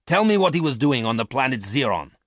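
loud, sharp command; sharp order. Acted. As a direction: bark the demand. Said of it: "he ordered sharply".

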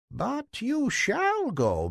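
high, slow, grand fairy godmother. Acted. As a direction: promise it like a queen of fairies. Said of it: "said the old woman".

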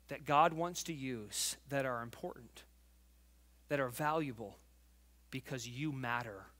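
low, soft, sincere appeal; tender preacher speaking from the heart; heartfelt. Real, not acted. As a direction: sink gradually toward a hush, pausing between phrases. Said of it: "Hear my heart today".

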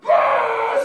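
very high, loud blare; shofar blast imitation. Imitating the horn blown in the face, shofar blast.

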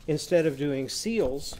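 usual speaking voice

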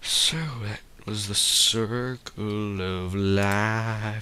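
slow, grand mock-profound delivery; mock solemn. Vlogger mock-philosophizing about the story, slow and solemn. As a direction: intone it like a nature narrator.

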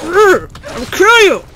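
loud, high game-play threat; combat yell. Pretend. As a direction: yell it mid-fight at the enemy. Yelling at enemy in combat.

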